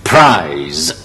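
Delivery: teacher models prize; correct pronunciation. Shown as a demonstration. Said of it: "再嚟一個P".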